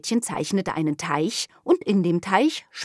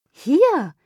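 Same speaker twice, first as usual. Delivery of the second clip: excited; 10 semitones higher, 8 dB louder than usual. Acted. Girl answers eagerly.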